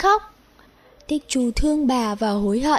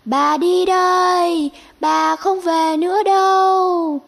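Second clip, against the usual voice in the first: higher, slow, sorrowful farewell; sad bird grandmother; 6 semitones higher, 4 dB louder, slower than usual. Acted. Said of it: "Nói rồi chim vỗ cánh bay đi". (giọng chim bà buồn bã từ biệt)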